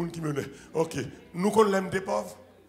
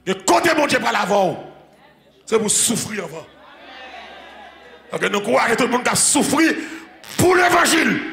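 louder, rising sermon cry; preaching at full force; 5 semitones higher, 8 dB louder than usual. Acted. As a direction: drive the point home to the church. Preaching climax to congregation. (prédication enflammée, à pleine voix)

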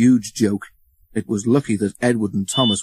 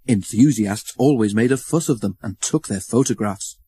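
ordinary delivery

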